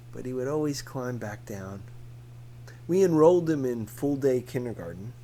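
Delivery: ordinary delivery, nothing marked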